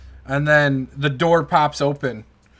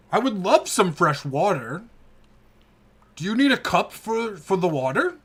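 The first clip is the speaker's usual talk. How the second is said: put-on eager old orc; 5 semitones higher than usual.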